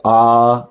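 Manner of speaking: long, held आ; sustained आ with effort; tense long vowel. Shown as a demonstration. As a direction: stretch आ out firmly and evenly. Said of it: "कठोर है".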